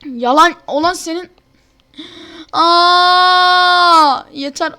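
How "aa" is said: crying shout as he drops; screaming while falling. Real, not acted. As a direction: yelp of despair mid-fall. Falling in game.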